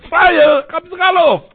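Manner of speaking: high, loud call to wake everyone; fire alarm yell. Retold or imitated. Watchman rouses the sleeping town, loud high yell. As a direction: yell the townspeople out of their beds. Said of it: "Aber Thomas schreit".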